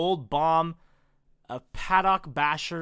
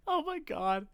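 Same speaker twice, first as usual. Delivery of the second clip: ordinary talk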